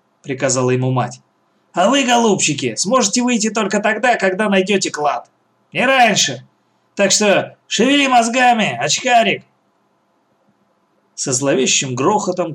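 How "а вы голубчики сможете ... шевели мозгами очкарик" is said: mocking old woman. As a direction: lord it over the trapped kids. Old woman locks children in.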